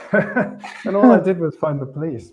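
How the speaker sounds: real laugh through the words, slow and loud; amused, self-deprecating laugh while speaking. Real, not acted. Laughs at his hero status.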